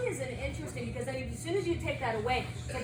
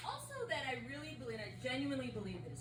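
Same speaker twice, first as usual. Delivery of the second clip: much softer, faster heartfelt words; sincere; 9 dB quieter, faster than usual. Real, not acted. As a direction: speak from the heart, softly. Sincere closing message.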